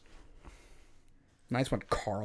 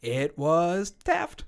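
triumphant reveal; 6 semitones higher, 5 dB louder, much slower than usual. Real, not acted. Triumphant quiz answer confirmation.

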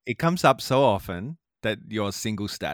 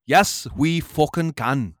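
slow, loud Scottish-accented slogan; Obama; 2 semitones higher, 5 dB louder, slower than usual. Shown as a demonstration. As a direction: hammer out the slogan in mock Scottish, drawn out. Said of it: "It's my best Scottish Obama".

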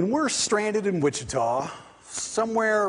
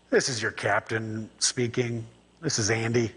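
low, slow, lightly voiced airline captain on the intercom; a hint of a calm captain speaking to passengers; pilot announcement; 7 semitones lower than usual. Pilot comes on.

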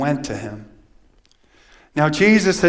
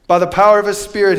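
ordinary talk

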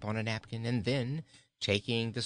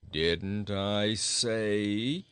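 slow goat; 4 dB louder, much slower than usual. A narrator's reading. Goat begins retort.

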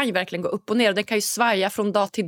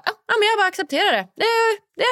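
much higher, fast, sing-song dismissive; mocking a too-simple "just accept it"; mock breezy acceptance; 12 semitones higher, much faster than usual. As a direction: parody how easy it sounds. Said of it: "det låter så enkelt när man säger".

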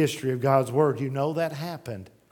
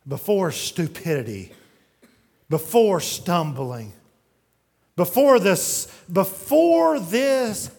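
each before louder and higher, the last one slow; 3 semitones higher, 6 dB louder, slower than usual. Acted. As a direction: build the list to a loud peak. Preacher building repetition.

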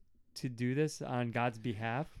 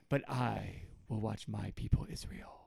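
slow, softer solemn declaration; a hint of a grand wizard, slowed down; lightly voiced Gandalf; 3 semitones lower, 6 dB quieter than usual. God claiming his own people among the nations, slow and solemn. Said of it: "it's kind of a neat Gandalf moment".

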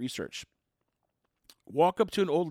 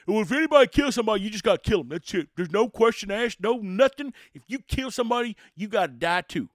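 louder, fast hardline rant; loud mock rant, quick at the start; mock tough guy rant; 4 semitones higher, 7 dB louder than usual. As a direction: rattle it off like a hang-em-high hardliner. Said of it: "I'm not the guy that says".

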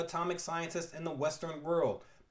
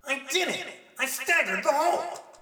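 triumphant hero; 11 semitones higher, 5 dB louder than usual. Acted. Spidey triumphs after the punch.

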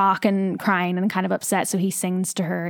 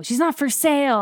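retold scream; 7 semitones higher than usual. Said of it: "And he screams".

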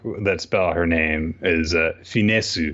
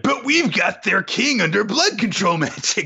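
high-pitched hammed-up Asta outburst; eager outburst; 13 semitones higher than usual. Acts out Asta's eager outburst.

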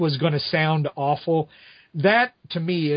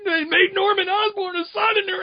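exasperated outburst. Real, not acted. A critic griping about the Southern villain, in a pained high cry.

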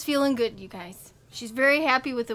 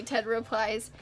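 usual speaking voice

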